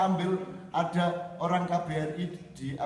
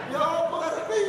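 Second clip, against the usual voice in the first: much higher, exasperated; exasperated retort; 10 semitones higher, 3 dB louder than usual. Retold or imitated. Speaker throws his indignation at the guard, high and exasperated. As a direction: toss back a fed-up what do you want.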